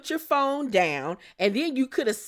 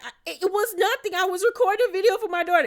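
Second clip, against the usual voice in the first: mock flustered Brittany; 10 semitones higher, much faster than usual. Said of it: "she was like".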